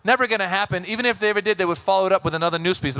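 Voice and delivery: ordinary delivery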